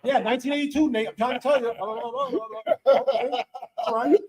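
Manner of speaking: high-pitched, hammed-up coach impression; Coach Gardner. Coach spitting out stats on an obscure athlete, high hammed-up impression. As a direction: spout stats high, sliding into blah-blah. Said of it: "Coach Gardner is very weird like that".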